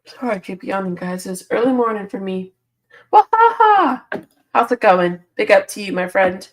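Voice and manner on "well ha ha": high, slow fake "ha, ha"; hammed-up high mock laugh. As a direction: play a stagey little laugh. Greeting a viewer playfully.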